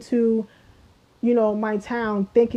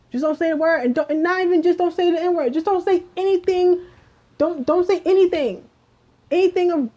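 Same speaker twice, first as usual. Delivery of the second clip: high, insistent frustrated lecturing; exasperated; 5 semitones higher than usual. Real, not acted. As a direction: keep hammering the point, voice up high. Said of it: "I'm getting aggravated".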